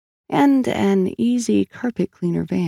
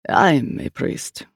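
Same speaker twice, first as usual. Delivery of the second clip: slow priest; 2 semitones lower, much slower than usual. A narrator's reading. Priest corrects the detective.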